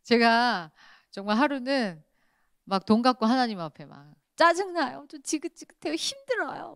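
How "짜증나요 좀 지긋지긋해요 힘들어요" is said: 높은 목소리로 칭얼대듯 불평; 짜증난다는 듯 투덜거리기. Retold or imitated. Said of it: "원망불평할 때". (하나님께 원망불평하는 기도, 높고 칭얼대게)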